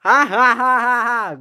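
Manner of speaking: loud, high acted "ha ha" laugh; hammed-up hysterical laughter. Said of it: "Gordon was laughing hysterically".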